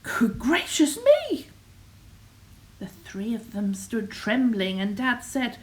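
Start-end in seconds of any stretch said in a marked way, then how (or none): 0.0-1.5 high astonished outburst; hammed-up motherly astonishment; shocked exclamation. A narrator's reading. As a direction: exclaim in storybook shock, pitched up. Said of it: "and exclaimed".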